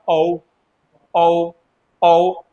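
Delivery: औ drawn out as a clear open vowel; औ sound drilled. Shown as a demonstration. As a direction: say औ slowly, repeated. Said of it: "तो इसको पढ़ो जरा दो से तीन बार". (औ का लंबा, साफ़ उच्चारण)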